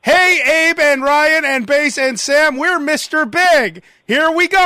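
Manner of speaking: high, slow, hyped-up band hello; band's recorded greeting. Retold or imitated. Host imitating the band's taped intro, high showy greeting. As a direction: sing out a stretched, showy greeting. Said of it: "and it'd be like".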